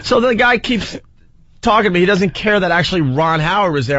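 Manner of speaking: ordinary talk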